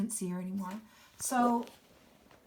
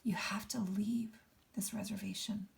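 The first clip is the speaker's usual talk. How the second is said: secretive hushed whisper; 7 dB quieter than usual. Acted. Mister P confiding a secret to Junior, in a hushed whisper. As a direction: lean in and whisper it. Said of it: "didn't want even the trees to hear".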